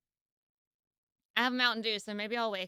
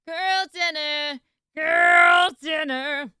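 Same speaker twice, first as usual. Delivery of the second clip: loud slow drawn-out chant; shouting; 4 semitones higher, 9 dB louder, much slower than usual. Pretend. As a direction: belt it out, stretched and loud. Chanting meme catchphrase.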